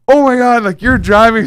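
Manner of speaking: high, loud retold "oh my God"; sudden alarmed realization. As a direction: jolt into acted alarm at the wheel. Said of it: "all of a sudden I realize".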